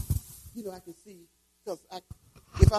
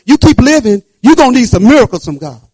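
preaching loud; 4 semitones higher, 9 dB louder than usual. Acted. Preacher exhorting congregation.

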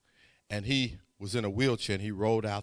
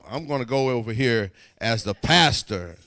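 louder, laughing declaration of being the pastor; bold announcement; 2 semitones lower, 9 dB louder than usual. Real, not acted. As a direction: proclaim it with a chuckle. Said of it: "like a pastor would".

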